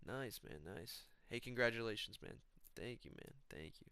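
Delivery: barely audible, soft-spoken impression; Joshua Van. Young fighter greeting someone he just met, barely audible. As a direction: imitate a quiet dude, almost whispering. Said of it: "He's too soft-spoken when I met him".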